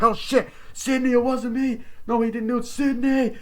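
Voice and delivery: high, loud, frantic protest; panicked Billy. Retold or imitated. Billy begging Sydney during his arrest, frantic and high. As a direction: plead desperately, voice up high.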